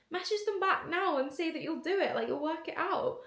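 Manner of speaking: high-pitched imitated mum giving orders; a mother urging her daughter to take the job, high mock voice; mum's insistent order.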